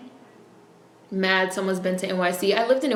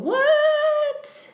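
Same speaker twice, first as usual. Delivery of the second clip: sudden squeal of disbelief, pitched way up; shocked what. Real, not acted. Reacting to a hair story, high shocked squeal. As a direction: jump high in pure disbelief.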